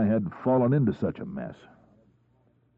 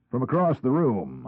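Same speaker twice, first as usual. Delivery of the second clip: ordinary delivery, nothing marked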